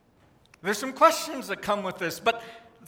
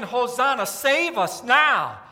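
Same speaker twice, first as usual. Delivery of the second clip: louder, with save us now drawn out slowly; slow crowd plea; 2 semitones higher, 5 dB louder, slower than usual. Retold or imitated. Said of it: "they're yelling".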